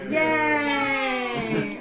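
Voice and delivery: put-on high shouted child cheer; kid voice. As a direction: cheer like an excited little kid. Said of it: "what an odd-sounding child".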